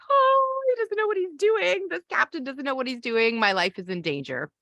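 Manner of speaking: a sudden high yelp of alarm, then settling down; imitating a scared passenger who doubts the captain; a mock-panicked passenger; frightened passenger. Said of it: "you would probably be like".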